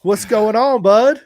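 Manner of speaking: high, loud happy hello; excited loud greeting. Real, not acted. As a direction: call out to him, delighted he's here. Greeting friend's dad on arrival.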